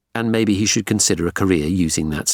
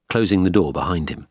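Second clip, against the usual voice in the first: ordinary delivery, nothing marked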